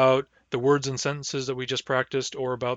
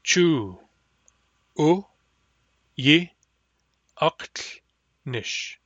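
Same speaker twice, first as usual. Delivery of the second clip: each syllable said apart with pauses; syllable-by-syllable drill; much slower than usual. Shown as a demonstration. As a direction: split it into separate syllables. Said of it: "by syllables too".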